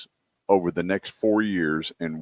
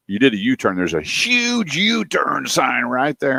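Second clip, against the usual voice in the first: HUGE drawn out long and high; retold cop's scolding, stretching "huge" way out; incredulous cop; 5 semitones higher, slower than usual. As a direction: lean hard and slow on "huge U-turn". Acted cop scolding the driver.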